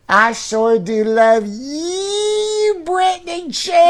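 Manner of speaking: very slow, high Southern doll drawl; Southern baby doll. Pretend. Host voicing a Southern talking baby doll, slow Southern drawl. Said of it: "this is a Southern baby".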